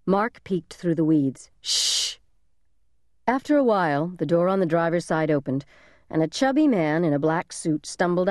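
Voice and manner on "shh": unvoiced "shh" to silence someone; sharp hushing shh. A narrator's reading. Big brother silencing little brother in hiding, hushing. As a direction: hush him with a hissed shh.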